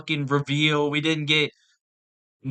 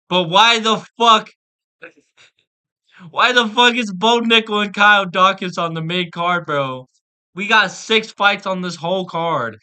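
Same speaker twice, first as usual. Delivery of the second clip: shouting in outrage, much higher and louder; furious rant; 5 semitones higher, 8 dB louder than usual. Real, not acted. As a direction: yell it out, fed up. Ranting about the fight card.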